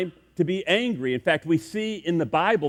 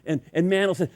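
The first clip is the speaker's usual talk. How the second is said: usual speaking voice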